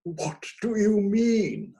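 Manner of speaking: slow, high stagey delivery; drawn-out melodramatic question; vocal italics. A narrator's reading. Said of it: "whispering in vocal italics".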